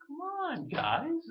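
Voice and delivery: very high sing-song call; high, falsetto mock cheer; mock chipper. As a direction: call the group like an eager kid.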